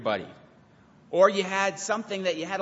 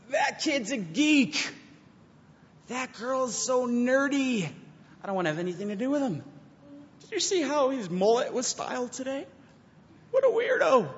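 mocking gossip; 8 semitones higher than usual. Youth pastor mimics students gossiping, in a high, sneering voice. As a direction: sneer at the geeky kid like a snooty teen. Mocking how kids talk at church.

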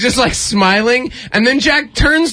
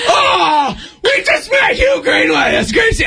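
excited shouting; 13 semitones higher than usual. Retold or imitated. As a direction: squeal it like an excited kid. Kid's excited shouting quoted.

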